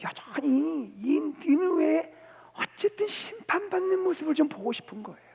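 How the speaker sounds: lifted voice, dragging the words out; stubborn inner wish. Acted. Preacher voicing Jonah's wish to see Nineveh judged, stubbornly.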